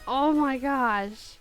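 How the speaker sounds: ordinary delivery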